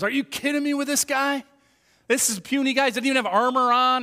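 mocking Goliath. Goliath sizing up unarmored David, mocking disbelief. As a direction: make fun of a puny opponent. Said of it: "when he makes fun of David".

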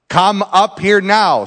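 usual speaking voice

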